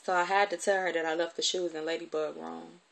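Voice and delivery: usual speaking voice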